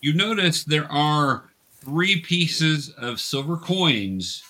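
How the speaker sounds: ordinary talk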